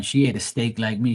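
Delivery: ordinary talk, nothing marked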